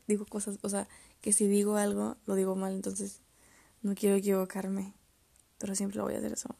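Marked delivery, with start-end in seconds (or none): none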